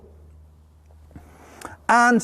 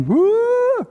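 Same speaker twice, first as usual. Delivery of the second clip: excited cheer; 13 semitones higher than usual. Retold or imitated. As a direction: celebrate the baby's first step. Cheering baby's first step.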